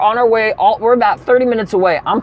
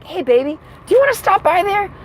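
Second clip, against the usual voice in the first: high, joking proposition; playful high voice; 13 semitones higher than usual. Retold or imitated. Couple joking around about the theater, playful high voice. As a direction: suggest it teasingly, far up in pitch.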